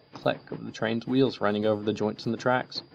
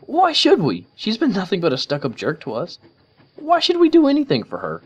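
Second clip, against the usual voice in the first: high, loud, indignant pony; scowling Rainbow Dash; 12 semitones higher, 8 dB louder than usual. A narrator's reading. Rainbow refuses to help Trixie, loud and resentful. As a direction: spit out her resentment. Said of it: "Dash scowled".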